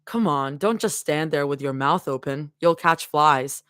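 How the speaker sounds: low, steady read-aloud of a fanfic chapter; story narration. A narrator's reading. Streamer reading a fanfic chapter to chat, low and steady. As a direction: read it like a cozy audiobook.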